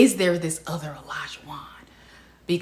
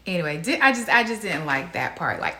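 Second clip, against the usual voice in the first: usual speaking voice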